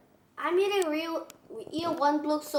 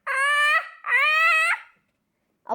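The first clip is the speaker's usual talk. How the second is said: rising uh-uh before a sneeze; sneeze wind-up; 12 semitones higher, 5 dB louder than usual. Acted. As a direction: build toward a sneeze. Gerald sneeze building up.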